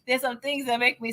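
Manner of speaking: usual speaking voice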